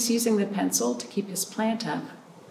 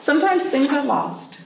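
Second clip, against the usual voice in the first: higher, louder "Sometimes things are lost"; storybook refrain; 8 semitones higher, 5 dB louder than usual. A narrator's reading. Refrain for the children, lifted up high. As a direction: pitch "Sometimes" up high, fall away.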